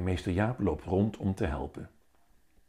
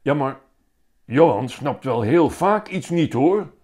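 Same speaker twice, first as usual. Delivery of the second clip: loud, higher blurt; 6 semitones higher, 9 dB louder than usual. A narrator's reading. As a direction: blurt it out before thinking. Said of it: "flapt Marjolein eruit". (eruit geflapt, luid en hoog)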